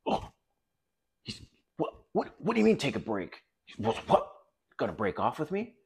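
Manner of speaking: a girlfriend thrown by "take a break", mock alarm. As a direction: play her caught off guard and a little panicked, lightly hammed-up. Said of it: "she's gonna think".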